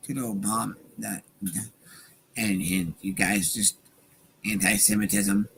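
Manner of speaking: low, soft mumbled imitation; mock a debater's hedging in a low, quiet voice; Ben Shapiro. Host mimicking a debater dodging questions, mock impression. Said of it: "do it in the Ben voice".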